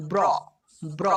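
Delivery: ব্র as one joined b-r sound; b running straight into r in ব্র; blended "br" sound. Shown as a demonstration. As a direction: model ব্র twice with a pause between. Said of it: "ব্র sound উচ্চারণ হবে".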